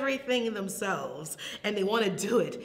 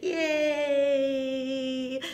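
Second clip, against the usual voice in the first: long, higher-pitched yay; sustained storytime cheer; 5 semitones higher, 4 dB louder than usual. Pretend. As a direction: cheer for toddlers, drawn out. Cheering at the rhyme's end.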